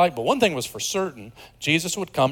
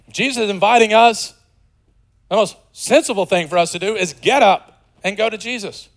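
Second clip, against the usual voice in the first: ordinary talk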